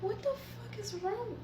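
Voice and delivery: high, hushed, stunned question; incredulous disbelief. Retold or imitated. To Miles waving a spoon from her purse, hushed high disbelief. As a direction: let it slip out in soft, high disbelief.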